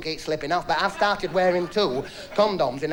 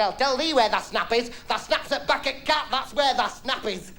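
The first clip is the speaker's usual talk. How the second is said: Yorkshire wife snapping back, pitched up; 6 semitones higher than usual. Acted. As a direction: tell him off in broad Yorkshire. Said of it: "he were a Yorkshireman".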